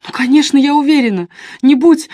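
high, loud, agitated insistence. A narrator's reading. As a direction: snap back, losing composure, then break off. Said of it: "Дебора взяла себя в руки".

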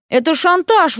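high-pitched indignant protest; indignant, voice jumping up. A narrator's reading. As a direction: object as if affronted. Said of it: "возмутился Поля".

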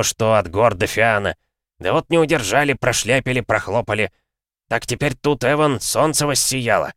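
worked-up, opinionated dwarf; aggrieved dwarf. Acted. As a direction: lament the lost workmanship with heat.

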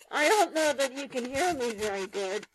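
muffled voice through a paper tube; talking through a paper tube. Shown as a demonstration. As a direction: speak into a rolled-up paper tube. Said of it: "made a little paper roll".

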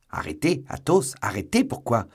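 loud, high, incredulous questions; shocked disbelief. A narrator's reading. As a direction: fire off stunned questions, voice jumping up. Stunned by friend's arrest. (stupeur incrédule, voix forte et aiguë)

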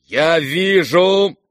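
high, drawn-out offended protest; indignant outburst. Acted. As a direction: take loud, slow offence. Offended general's outburst in story.

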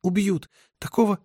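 high, strained woman's voice; choked-out, pleading distress; forced out. A narrator's reading. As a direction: squeeze it out in a high pitch. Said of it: "выдавила она".

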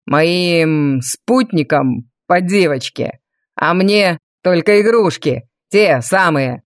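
low, slow menacing villain. A narrator's reading. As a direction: sneer it like a cold noble.

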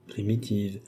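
"Primitives" repeated softly, pitch dropped; subdued echo. A narrator's reading. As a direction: echo "Primitives" quietly, as if weighing it. Same word echoed doubtfully, lower. (« Primitives » répété tout bas)

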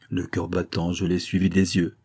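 usual speaking voice

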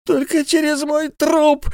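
very high, slow wail; wailing miser. A narrator's reading. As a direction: wail it as if dying. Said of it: "голосил Исаак Хакабут".

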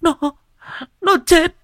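high, loud wailing cry; sobbing, choked with tears. A narrator's reading. Wife telling husband their son drowned, wailing. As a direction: break down, swelling into a wail. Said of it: "vừa khóc vừa nói trong tiếng nấc nghẹn ngào".

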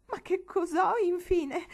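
high, loud tearful outburst; bursting into tears. Acted. Frightened girl to her aunts, tearful outburst. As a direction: cry out the question near tears. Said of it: "scoppiò a dire Marigold in lacrime".

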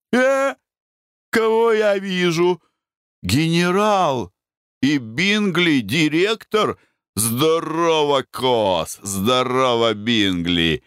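high, slow hearty hail. Acted. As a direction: call out to old pals. Foker hails actor friends.

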